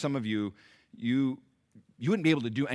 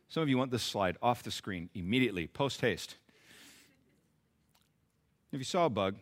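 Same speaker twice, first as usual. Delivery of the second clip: ordinary talk, nothing marked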